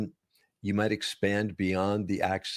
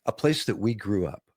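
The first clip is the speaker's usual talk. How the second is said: usual speaking voice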